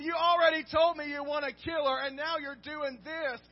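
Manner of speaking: loud, scolding king; indignant king. Retold or imitated. Preacher voicing the king confronting Haman, loud scolding. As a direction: lay down the law to Haman. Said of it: "he's thinking".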